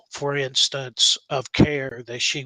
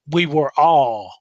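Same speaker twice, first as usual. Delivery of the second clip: "all" pushed out louder at the end; stress on all; 5 dB louder than usual. Real, not acted. Urging unsure nurse practitioners to precept, stress on "all". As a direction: lean hard on "all" so everyone is included. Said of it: "and I emphasize the word all".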